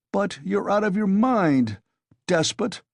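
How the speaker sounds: high, loud roar at a tyrant. A narrator's reading. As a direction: bellow him down. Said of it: "roared Razumian".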